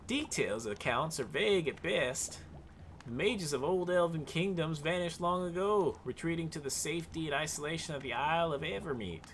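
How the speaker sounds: put-on Southern accent. Wizard Oric explaining his search for mythals, in a Southern accent. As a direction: play the scholar wizard as a Southern gent. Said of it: "much more southern now with the accent".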